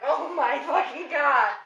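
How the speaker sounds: high, slow, drawn-out gush; hammed-up, overwhelmed squeal; childlike fan swoon. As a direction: stretch it out in fangirl agony. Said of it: "I'm immediately a child".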